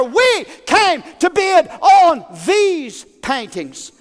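outraged bidders demanding answers; stirred-up crowd. Acted. Crowd shouting at the auctioneer, indignant and demanding. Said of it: "Everybody was stirred".

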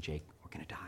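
low, very soft deadpan aside; hushed mock confession. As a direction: quietly break mock bad news. Joke confiding doom to son.

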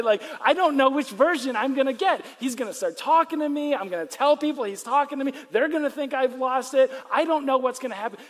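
anxious rant. Retold or imitated. A believer fretting about the Spirit, mock anxious. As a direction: spill the worries out in mock panic. Anxious imagined inner monologue.

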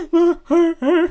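loud, very high mock wail; hammed-up inconsolable kid sobbing; super dramatic crying. Crying boy answering the office staff, super dramatic. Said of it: "Super dramatic".

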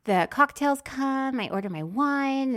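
ordinary talk

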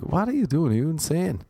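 high-pitched incredulous questioning; hammed-up high outrage; mock disbelief. Viewer's reaction to the confessing poster.

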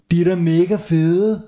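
put-on high loud kid voice; teasing shout. A narrator's reading. Hans mocking Gurli's trousers. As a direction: jeer it mockingly. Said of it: "råbte Hans".

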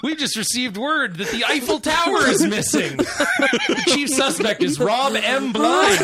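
excited high announcer with laughter; hammed-up game show announcer. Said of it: "sounds like a villain from Carmen Sandiego".

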